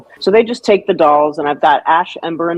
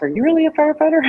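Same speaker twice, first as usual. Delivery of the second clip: put-on curious kid; 13 semitones higher, much faster than usual. Kids asking at a booth.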